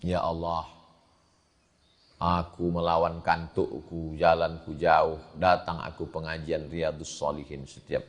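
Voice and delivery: soft, low, slowed pleading to God; a soft, earnest supplication; hushed mock prayer. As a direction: pray it quietly, like a humble plea. Imagined prayer of the fourth man.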